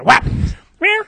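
spoken smack, then a mock cat yowl; imitated kick and meow. Imitating the cat he kicked, sound effects. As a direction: act out the hit and the meow.

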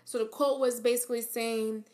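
normal talking voice

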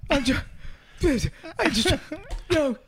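imitating an angry, sputtering customer. Host imitating comic asking store clerk, sputtering mock anger. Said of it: "he'd be like this. This would be Bo's version".

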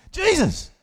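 high excited cry of Jesus; high, eager exclamation; eager call. Retold or imitated. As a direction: call out the name, bursting with a question. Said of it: "He says".

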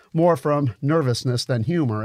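ordinary delivery, nothing marked

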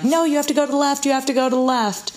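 urgent, high, quick mom voice; a mom barking directions from the back seat; mom giving directions. Retold or imitated. Mother insisting from the back seat, high and quick. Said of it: "she starts saying".

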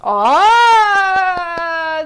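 long, high, loud "oh"; thrilled shout of awe; delighted fan squeal. Real, not acted.

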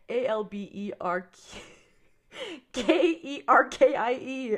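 spelling Albuquerque out letter by letter, slowly; sounding out words. Shown as a demonstration. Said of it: "You sounded it out".

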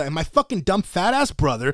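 ordinary talk, nothing marked

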